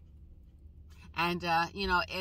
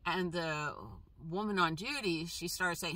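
normal talking voice

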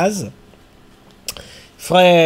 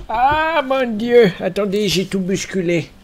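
startled; 4 semitones higher than usual. Real, not acted. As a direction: caught off guard by a clatter. Knocks things over.

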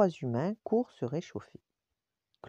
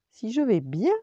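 high, loud storybook exclamation; exclaiming; 5 semitones higher, 6 dB louder than usual. A narrator's reading. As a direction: throw the question back excitedly. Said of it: "s'écria Lady". (exclamation vive, aiguë et forte)

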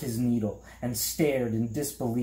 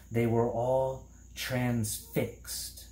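usual speaking voice

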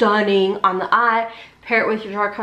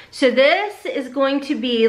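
ordinary talk, nothing marked

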